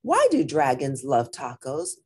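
usual speaking voice

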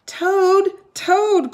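high, loud calling out for Toad; shouting. A narrator's reading. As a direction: shout his name up at the house. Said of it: "cried Frog".

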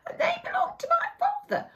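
put-on a high, hushed, fast boy's question; young Jack. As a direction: ask it breathless like an eager boy. Jack asking the old woman.

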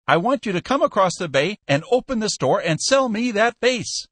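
high-pitched, forceful pleading; urgent insistence. A narrator's reading. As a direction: press a stranger urgently over the phone. Desperate buyer pleads by telephone.

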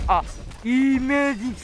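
sarcastic cheer. Acted. Mocking a bad hit.

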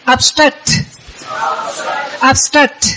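"Obstruct" with its closing T released; sounded final consonants. Shown as a demonstration. Teacher modelling for repetition, "Obstruct" with the final T sounded. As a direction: end "Obstruct" on an audible T puff. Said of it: "khi đọc là phải có âm cuối".

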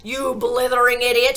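loud, furious outburst; bellowing headmistress; shouted insult. A narrator's reading. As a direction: hurl the insult with full force. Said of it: "shouted the Trunchbull".

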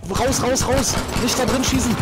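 urgent yell. Real, not acted. Panicked teammate yelling warning.

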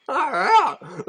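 high-pitched cry of disbelief; exasperated exclamation. Real, not acted. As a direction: let it leap up high in dismay. Exclaims at environmental damage.

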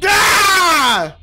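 loud, high scream of exasperation; frustrated scream. Real, not acted. Frustrated by game.

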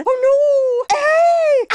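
high loud startled cry; alarmed yelp. Acted. Old lady knocked about in the race, shocked yelp. Yelps when hit.

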